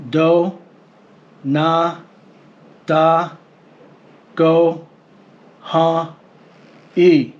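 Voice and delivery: "Do, na, da, go, ha, i" read out slowly, syllable by syllable, with pauses. Shown as a demonstration. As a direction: spell out each syllable separately. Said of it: "Here is the standard transliteration".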